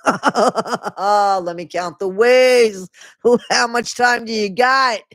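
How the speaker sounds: loud, drawn-out cackling jeer; gleeful mockery. Real, not acted. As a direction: laugh into it and stretch the words out high. Mocks memoir's what's wrong with me.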